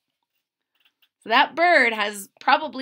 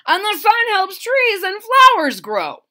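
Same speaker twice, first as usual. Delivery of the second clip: higher, louder boastful leaf; proud leaf; 11 semitones higher, 10 dB louder than usual. Acted. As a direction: brag to the other leaves. Yellow Hickory voiced in story.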